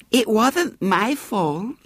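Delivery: put-on high, slow, whiny child's plea; plaintive little girl. A narrator's reading. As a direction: whine it innocently to escape blame. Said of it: "she said plaintively".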